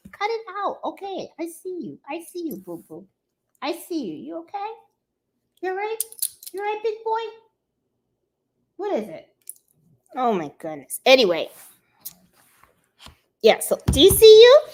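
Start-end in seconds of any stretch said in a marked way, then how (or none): 0.1-9.3 high, soft sing-song pet voice. Real, not acted. Owner cooing high and soft to her barking dog. 13.9-14.6 high, loud, fast squeal at the dog; excited pet talk. Real, not acted. Owner squealing high and loud at her dog.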